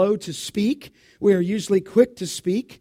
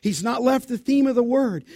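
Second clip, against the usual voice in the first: ordinary talk, nothing marked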